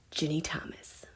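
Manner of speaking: hushed gasp of a name; whisper. Real, not acted. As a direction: breathe the name in awe. Gasping reveal of a card.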